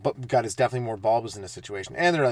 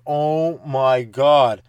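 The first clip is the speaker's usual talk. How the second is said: mocking kid; 7 dB louder, much slower than usual. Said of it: "the one kid's like".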